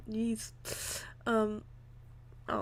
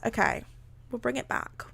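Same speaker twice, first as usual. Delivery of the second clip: ordinary delivery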